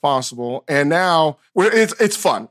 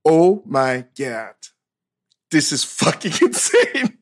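slow, low, amazed with laughter; awed; 3 semitones lower, slower than usual. Retold or imitated. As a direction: let the wonder slow you down. Awe at first car.

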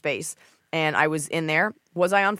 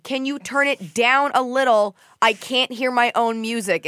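pointed retort; 6 semitones higher, 5 dB louder than usual. Retold or imitated. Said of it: "I go".

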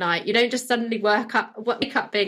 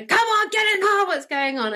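higher pitched mock exasperated nag at the kids; impatient parent yell; 10 semitones higher than usual. Parent late for school hurrying kids into the car, mock exasperated high yell. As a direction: imitate yourself losing it, pitched high. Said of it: "blowing my lid".

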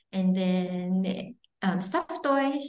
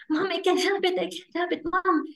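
higher imitation of her son pleading; child voice; 7 semitones higher than usual. Son begs mom for pen and paper, higher pleading child voice. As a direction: play the little boy asking mom for help, higher. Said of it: "I said".

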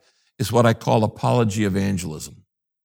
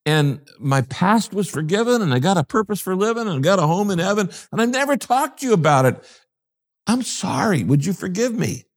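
flustered confession; 7 semitones higher than usual. Pretend. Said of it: "The guy's now going".

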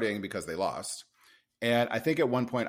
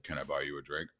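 low, soft put-on manly voice; bass in voice; 8 semitones lower, 9 dB quieter than usual. Bar-goer trying to impress a stranger, deep put-on voice. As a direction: sink the pitch for a smooth pickup line. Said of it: "try to get the bass in my voice".

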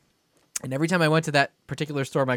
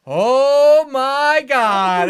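very slow, higher, louder oh my god; horrified gasp; 11 semitones higher, 8 dB louder, much slower than usual. Retold or imitated. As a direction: draw it out in horror. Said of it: "my grandmother's going".

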